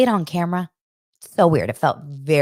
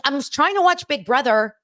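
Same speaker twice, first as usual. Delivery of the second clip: annoyed; 9 semitones higher than usual. Retold or imitated. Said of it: "I'm like".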